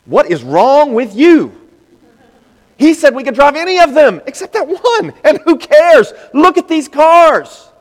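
high, loud, slow exasperated reply; indignant enthusiasm. Acted. Preacher voicing the brothers' retort, high and loud. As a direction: scoff and rave about the cars.

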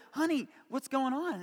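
high-voiced mom checking on her child; imitated concerned mom. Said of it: "She's like".

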